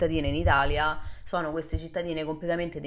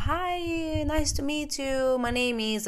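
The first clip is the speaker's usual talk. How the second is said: high, slow friendly American greeting; chirpy high voice of the roommate, drawn out; bubbly American guy saying hello; 10 semitones higher, much slower than usual. Retold or imitated. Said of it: "il roommate mi fa".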